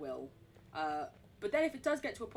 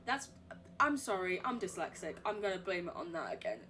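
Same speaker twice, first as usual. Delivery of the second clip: ordinary delivery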